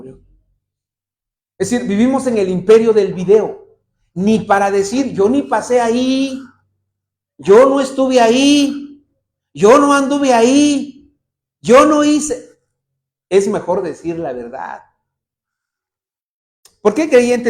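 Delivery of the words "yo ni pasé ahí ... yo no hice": slow, higher, louder insistence on yo; insistent denial. Retold or imitated. Liar insisting he was never there, slow and emphatic. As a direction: hammer each yo like a cornered liar.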